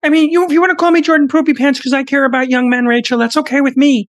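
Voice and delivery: high, pleading lecturer voice held right through; earnestly channel Jordan Peterson defending young men; Jordan Peterson impression. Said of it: "who's better at impressions?".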